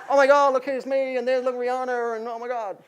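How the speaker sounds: high-pitched, gushing LA teen impression; teenage LA accent. Teen showing off concert selfies, in a hammed-up LA accent. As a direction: put on a high teenage LA accent, starting loud. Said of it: "That was my best teenage L A accent".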